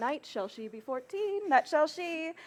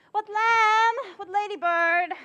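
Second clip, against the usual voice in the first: high, loud summoning call; 8 semitones higher, 8 dB louder than usual. Acted. Nurse summons Juliet for her mother, calling out. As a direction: call loudly for someone out of sight.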